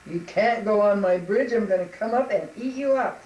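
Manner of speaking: loud troll bluster; booming storybook monster; threatening troll. Acted. Troll threatens little goat.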